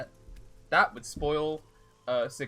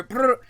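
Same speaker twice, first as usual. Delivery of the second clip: rain noise; 10 semitones higher, 13 dB louder than usual. Retold or imitated. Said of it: "Rain go".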